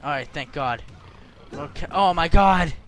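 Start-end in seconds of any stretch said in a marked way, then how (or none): 1.9-2.7 loud, raised-pitch outburst; shocked exclamation. Real, not acted. Player relieved then shocked again, loud exclamation. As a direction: exclaim it in alarm.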